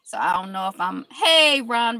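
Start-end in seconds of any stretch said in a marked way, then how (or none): 1.1-2.0 loud sudden hey; calling out. Real, not acted. Greeting Ron through the window, a loud hey. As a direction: call out to someone passing.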